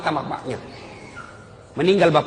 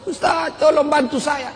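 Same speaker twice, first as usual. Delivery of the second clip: slow, high, pleading mock sob; drawn-out imitation of tearful begging; imitated crying plea; 9 semitones higher, slower than usual. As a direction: beg for help like a weeping woman.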